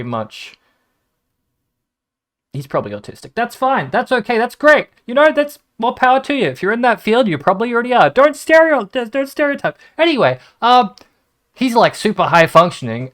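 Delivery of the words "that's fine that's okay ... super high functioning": high, louder hurried backpedalling; flustered backpedal. Real, not acted. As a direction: squeak out the take-back, suddenly flustered.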